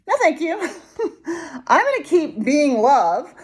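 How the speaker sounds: high, amused brush-off; laughing refusal. Real, not acted. Speaker refusing to act inauthentically, laughing dismissal. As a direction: decline brightly through a laugh.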